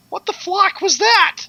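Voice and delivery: high, mock-scandalized outburst; Laura. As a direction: squeal it in mock disbelief. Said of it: "Laura's gonna come here tomorrow and say".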